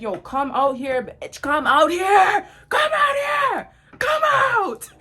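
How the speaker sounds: rising to a high, loud, slow shout; yelling her out. Retold or imitated. Calling the girl out of the classroom, shouting. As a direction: scream for her to come out.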